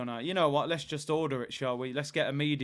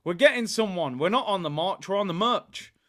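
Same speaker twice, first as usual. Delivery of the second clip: excited shout; 4 semitones higher, 7 dB louder than usual. Retold or imitated. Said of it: "I said".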